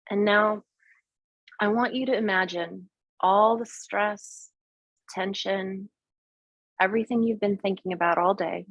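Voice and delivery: calm, unhurried meditation guide; guided meditation. Acted. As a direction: talk them gently into the visualization. Leads a guided visualization.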